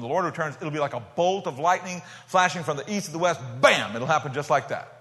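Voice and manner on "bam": one loud punched word; sharp loud burst; sudden bam. Acted. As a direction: slam it like a thunderclap. Lightning flash of the return.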